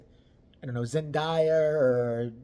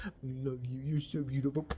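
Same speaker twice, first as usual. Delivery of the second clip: mock tender boyfriend; 4 semitones lower, 8 dB quieter than usual. Imagined boyfriend.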